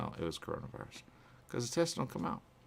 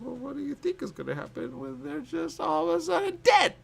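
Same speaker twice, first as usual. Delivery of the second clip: voiced friend; 6 dB louder than usual. Friend's voice in retold talk.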